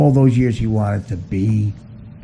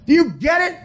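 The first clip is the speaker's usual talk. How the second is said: much higher shouted bets, laughing through; mock caddie shouting bets. Comic mocking celebrities with caddie betting shouts. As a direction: holler the wagers like a rowdy caddie. Caddyshack bet parody.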